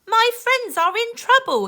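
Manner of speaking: high, fast worried pirate line. Acted. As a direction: fret about his friends. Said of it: "he frowned".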